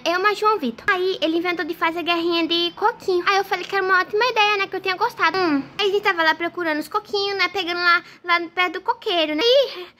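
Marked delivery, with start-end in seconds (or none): none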